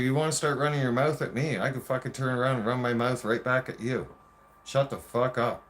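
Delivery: agitated, forceful comeback; worked-up confrontation. Retold or imitated. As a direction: fire back at a partner in a retold fight. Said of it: "I get excited just talking about that shit".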